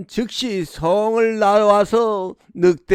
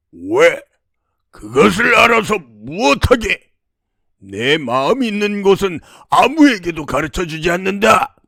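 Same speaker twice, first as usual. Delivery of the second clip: loud, imperious refusal; demon's commanding bellow; 4 dB louder than usual. Acted. Demon refuses to tell the princess his secret, bellowing. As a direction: roar the question back, then refuse. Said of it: "호령하고는".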